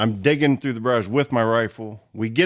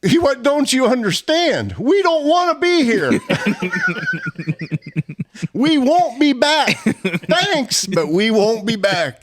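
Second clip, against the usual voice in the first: high, slow, laughing insistence; laughing protest; 10 semitones higher, 3 dB louder, slower than usual. Retold or imitated. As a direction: insist while cracking up. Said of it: "And I'm like".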